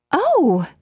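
high, sudden gasp of surprise; startled cry; startled exclamation. A narrator's reading. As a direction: jump at what's behind the door. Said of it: "she exclaimed".

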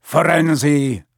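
drawn-out, thundering rebuke; thundered. A narrator's reading. Centaur catching another with a rider, thundering. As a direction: call out the name in centaur outrage. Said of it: "Bane thundered".

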